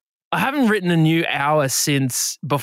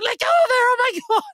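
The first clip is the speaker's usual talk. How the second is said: very high frantic shriek; hammed-up screaming in panic; mock scream. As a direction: imitate an alarmed bystander. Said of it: "started screaming".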